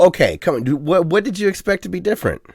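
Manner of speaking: ordinary talk, nothing marked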